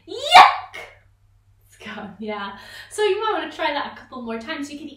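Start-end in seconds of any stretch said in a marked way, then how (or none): 0.1-0.8 very high, very loud squealed yuck; shrieked yuck; disgusted yuck. Shown as a demonstration. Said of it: "or yuck".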